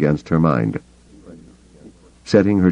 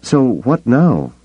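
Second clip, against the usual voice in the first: shaken; 7 semitones higher, slower than usual. Acted. Mara shaken, sweating.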